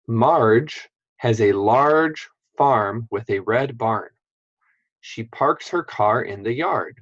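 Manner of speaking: the sentence read slowly, word by word. Shown as a demonstration. Teacher reads the sample sentence slowly to the class. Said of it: "Let's put those sounds into a sentence".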